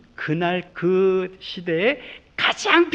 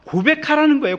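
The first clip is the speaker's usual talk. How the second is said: emphatic call; 10 semitones higher, 6 dB louder than usual. Acted.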